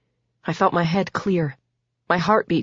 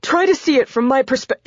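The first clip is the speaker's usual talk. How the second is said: higher, frightened begging; pleading; 9 semitones higher, 4 dB louder than usual. A narrator's reading. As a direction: beg for your life. Damon begging, cut off.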